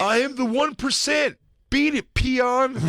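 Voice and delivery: high-pitched mock snob; snobbish taunt. Rich snob dismissing the poor, haughty taunt. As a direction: pitch it up and dismiss them, dragging out the brush-off.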